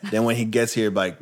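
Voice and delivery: ordinary talk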